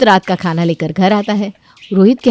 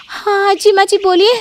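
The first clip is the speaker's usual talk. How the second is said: put-on much higher, obedient young wife; meek daughter-in-law; 12 semitones higher than usual. Daughter-in-law answering her mother-in-law, meek. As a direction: answer sweetly and dutifully.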